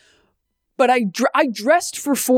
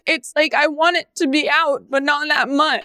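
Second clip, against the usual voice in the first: mock whining; 10 semitones higher than usual. Ironic privileged whining.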